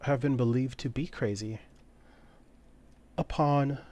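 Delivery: high and soft, an ancient being; mysterious entity. Acted. Ancient entity answers Beatrix softly in her mind. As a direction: speak softly, like something old and unseen.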